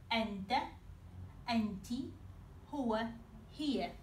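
ordinary talk, nothing marked

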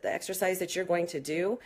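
normal talking voice

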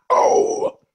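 shrill metal yell; high rock wail. Pretend. Rock scream after lyric.

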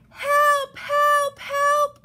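high, loud cartoon cries; crying for help. A narrator's reading. Little Blue Truck stuck tight, high cries for help. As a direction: call out urgently in a small truck's high voice. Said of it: "cried the Little Blue Truck".

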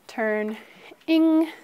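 ordinary talk

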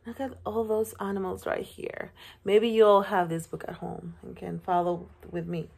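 ordinary delivery

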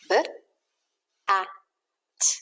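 segment "B, A, T" sound by sound; sounding out letters. Shown as a demonstration. Teacher to class, sounding out "B, A, T" one by one. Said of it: "Let's sound out bat".

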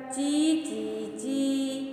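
slow, soft sing-song bird chirp; dainty birdsong imitation; sparrow chirp. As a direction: chirp it sweetly like a little sparrow. Bird's song in the story.

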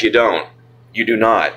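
ordinary talk

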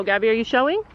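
ordinary talk

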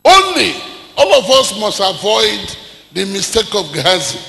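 loud rising sermon cries over music; preaching at full force. Acted. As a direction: build the sermon up to a shout.

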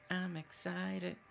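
high, soft, very slow sing-song; playful excitement. Pretend. Repeating the thrill of cutting a tree, playful sing-song. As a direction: stretch it out softly on a high note. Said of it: "so I'm excited".

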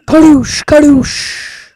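loud, high launch shout; catchphrase yell. Real, not acted. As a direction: yell the catchphrase as the bird flies. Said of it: "It's my thing".

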